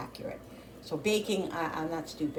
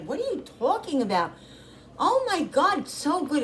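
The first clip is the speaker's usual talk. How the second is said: son-in-law's disbelief; 10 semitones higher than usual. Retold or imitated. Mom imitating her son-in-law insisting the food is great, raised. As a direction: act amazed that she doubts her food. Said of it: "He's like".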